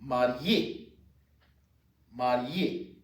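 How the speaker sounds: "marié" said mah-ree-AY, rising; French "marié" with a rising end; pronunciation model. Shown as a demonstration. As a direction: model "marié" twice for learners. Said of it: "And married is".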